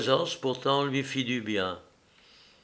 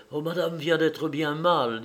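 put-on higher-pitched, anxious old maid; worried old housekeeper; 4 semitones higher than usual. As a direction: fret aloud as the housekeeper.

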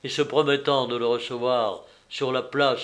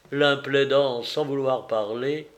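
ordinary delivery

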